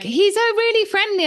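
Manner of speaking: high, sing-song owner voice; mocking dog owners. Runner mocking dog owners' excuse, high sing-song parody. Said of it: "when they're like".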